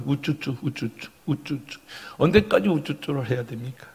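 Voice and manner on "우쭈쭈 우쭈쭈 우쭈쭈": put-on very soft repeated baby-coaxing; muted cooing. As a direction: coo under your breath. Mimics coddling, tired of it. (작게 우쭈쭈 어르기)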